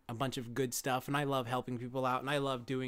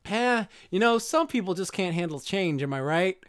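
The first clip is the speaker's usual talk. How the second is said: higher, jokey wisecracking voice; breaking the fourth wall with a grin; goofy cartoon turtle; 5 semitones higher, 4 dB louder than usual. Retelling a cartoon turtle joking to the audience, goofy voice. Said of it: "they're just like".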